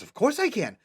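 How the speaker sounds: high-pitched, keen agreement; eager young helper; eager child. Acted. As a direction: agree eagerly as a little girl, voice way up.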